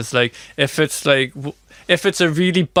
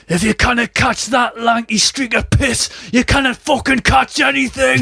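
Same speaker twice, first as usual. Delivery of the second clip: hammed-up Geordie terrace heckle; football terrace shout; 10 semitones higher than usual. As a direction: bellow it from the stand at a lanky player. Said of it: "shouted something like".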